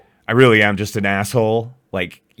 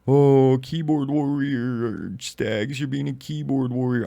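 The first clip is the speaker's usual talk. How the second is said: mock critic voice; 4 semitones higher, slower than usual. Mocking imagined critic's taunt.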